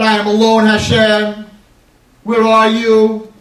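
loud, high anguished cry to Hashem; screaming and crying. Acted. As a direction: scream the question out, slow at the end. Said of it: "screaming and crying".

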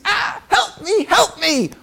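high, hammed-up damsel's scream; mock cry for help. Princess chased by fake bandits cries out in a high, hammed-up voice. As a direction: play the damsel in distress, very high.

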